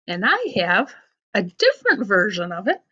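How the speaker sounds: usual speaking voice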